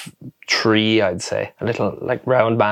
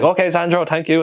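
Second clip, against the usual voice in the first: mirroring the farmer; 7 semitones higher than usual. Retold or imitated. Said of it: "the way you reflect people".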